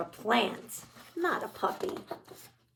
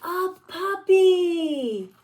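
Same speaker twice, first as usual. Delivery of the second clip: high, slow, loud dreamy sigh; longing pigeon; 7 semitones higher, 6 dB louder, much slower than usual. Acted. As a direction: stretch it out like a wish.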